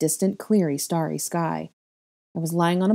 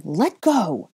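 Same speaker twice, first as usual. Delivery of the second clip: loud sharp order; angry; 2 semitones higher, 4 dB louder than usual. A narrator's reading. Boy shoving the girl away, loud. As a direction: push her away with it.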